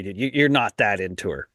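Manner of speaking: normal talking voice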